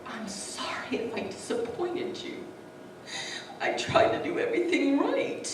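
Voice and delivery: anguished apology starting hushed and climbing high; half-voiced plea that rises, reliving a breakdown; retold anguished yelling. Wife reliving her outburst at God, anguished. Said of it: "I start yelling, and I'm like".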